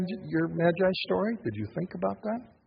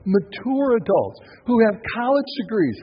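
usual speaking voice

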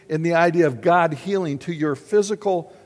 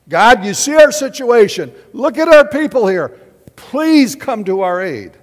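high, loud desperate plea; Moses pleading; 5 semitones higher, 11 dB louder than usual. Acted. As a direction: beg God loudly for help.

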